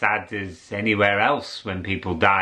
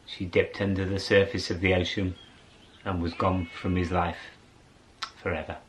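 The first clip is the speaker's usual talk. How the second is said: fading narration; 5 semitones lower, 7 dB quieter than usual. A narrator's reading. Storyteller fading to close.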